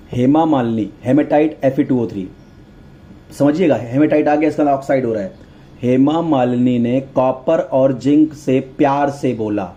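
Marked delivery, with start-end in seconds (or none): 5.8-9.6 slow, word-by-word recitation; slow teacherly repetition; drawn-out mnemonic. Shown as a demonstration. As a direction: spell out the memory phrase slowly, dropping at the end. Mnemonic repeated slowly for students.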